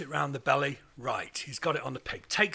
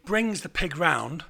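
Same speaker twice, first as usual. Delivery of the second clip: ordinary talk, nothing marked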